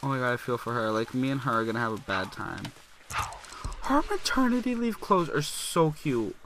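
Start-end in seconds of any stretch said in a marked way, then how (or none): 3.8-6.4 high, drawn-out gushing squeal. Real, not acted. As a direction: gush slowly in a high voice over something adorable. Gushing over cute maternity clothes.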